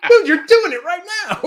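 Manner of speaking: can barely get it out for laughing. Real, not acted. Retelling own reply, laughing.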